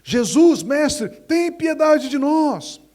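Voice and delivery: loud, high desperate plea; lepers crying out. Acted. Lepers crying to Jesus from a distance, loud and high. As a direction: cry out to Jesus from afar. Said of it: "e gritaram em alta voz".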